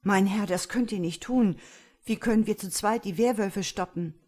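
desperate, strained objection; hoarse plea. A narrator's reading. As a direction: plead in alarm, slightly hoarse. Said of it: "fragte der Schmächtige mit heiserer Stimme".

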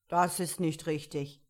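lightly voiced commanding caterpillar; caterpillar character voice. A narrator's reading. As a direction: question Alice as a stern caterpillar. Caterpillar judges the recital.

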